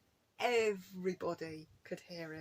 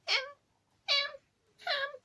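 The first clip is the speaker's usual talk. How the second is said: very high cat mew; imitated little meow; 4 dB louder, slower than usual. Imitating the cat begging for ham, little meow. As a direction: mew it so it almost says ham. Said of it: "his little meow is like".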